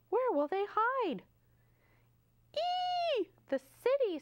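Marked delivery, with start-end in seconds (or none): 2.6-4.2 high excited squeal; piggy squeal, high and giddy. Acted. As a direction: squeal it out like a thrilled piglet. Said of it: "squeals the old pig".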